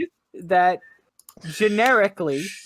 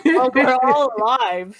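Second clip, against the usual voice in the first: laughing; 8 semitones higher, 6 dB louder than usual. Real, not acted.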